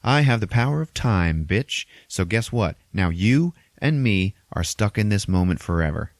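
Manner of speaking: cocky taunt, high at first then settling; smug, emphatic retold hero boast; Doctor Strange. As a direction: show off the winning trick to a foe. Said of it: "And he's like".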